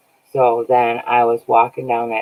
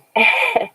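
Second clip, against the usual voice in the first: high bright burst of laughter; high real laugh; 4 dB louder than usual. Real, not acted. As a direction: laugh out loud at yourself. Laughs at own state.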